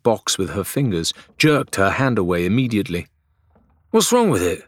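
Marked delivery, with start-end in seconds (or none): 3.9-4.6 high, urgent alarmed question. A narrator's reading. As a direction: demand it with sudden worry. Adelaide alarmed.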